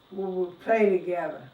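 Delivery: ordinary delivery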